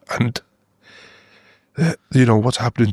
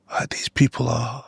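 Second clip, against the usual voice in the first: whispered; 4 semitones higher, 4 dB quieter, slower than usual. Real, not acted. Overcome describing Gaza's rescuers.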